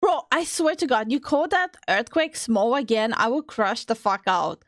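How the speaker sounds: ordinary talk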